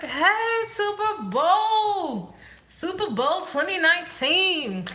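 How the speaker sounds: high, loud, stretched-out hype yell; hyped cheer. Retold or imitated. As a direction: cheer it like a mock sports fan. Acted quote of hyped person.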